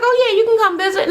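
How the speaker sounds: high, sugary mock brush-off; high put-on politeness; fake polite brush-off. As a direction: sweetly dodge the request. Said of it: "She was like".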